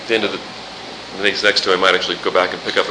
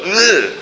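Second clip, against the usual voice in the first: disgusted ugh; 9 semitones higher, 3 dB louder than usual. Retold or imitated. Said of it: "you look at that and go".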